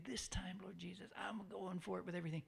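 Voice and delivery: hushed whisper to the Lord; whispered prayer. Shown as a demonstration.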